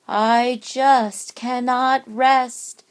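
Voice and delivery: slow, sorrowful ghost voice; guilty ghost. Acted. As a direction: drag it out, weighed down with guilt. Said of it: "The guilt-ridden ghost said".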